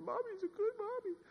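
put-on very high, soft little-girl voice; imitating a child in a high falsetto; four-year-old daughter. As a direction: squeak it like a small child protesting. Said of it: "She said".

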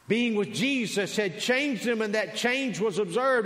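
high, emphatic pulpit delivery; full-force preaching. Real, not acted. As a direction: press each phrase on the congregation.

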